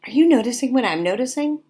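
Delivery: ordinary talk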